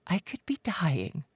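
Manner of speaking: slow, breathless whisper near tears; cracking whisper. Acted. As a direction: breathe it out, voice breaking. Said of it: "she whispered, her voice cracking".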